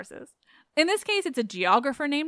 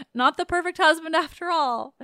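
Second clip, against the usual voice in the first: higher-pitched sarcastic remark; sarcasm; 6 semitones higher, 4 dB louder than usual. Real, not acted. Host remarks on Hades as a husband, sarcastic. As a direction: land it with obvious, lifted sarcasm. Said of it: "Can you hear my sarcasm there?".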